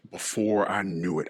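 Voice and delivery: normal talking voice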